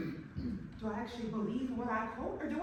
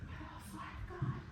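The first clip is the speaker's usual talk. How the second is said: soft resolve; 4 semitones higher, 6 dB quieter than usual. Acted. Quiet resolve after whisper.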